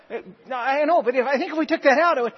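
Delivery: lightly voiced meek husband pushing his point; sheepish husband. Husband meekly insisting to angry wife, timid. Husband persists in acted argument.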